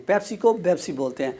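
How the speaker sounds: ordinary talk, nothing marked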